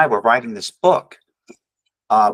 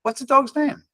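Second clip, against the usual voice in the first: higher chirpy retold question; man asking stranger dog's name, higher; bright question; 13 semitones higher than usual. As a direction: ask it lightly, upbeat. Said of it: "I asked".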